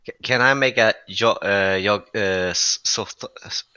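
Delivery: halting false starts on "yo-" and "so th-"; stumbling over pronunciation. Real, not acted. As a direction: break off "yo-" and "so th-", trying the hard name. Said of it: "have to be able to pronounce it".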